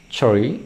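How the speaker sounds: "tree" starting with a crisp ch, rising; teacher repeating "tree" with ch; tree with ch onset. Shown as a demonstration. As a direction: say "tree" like chree. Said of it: "beda th sama c".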